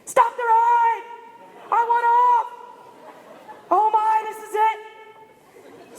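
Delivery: high, loud, drawn-out scream; acted panicked shrieking, words stretched long. Terrified rider begging to get off before the drop, screaming. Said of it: "I am just screaming".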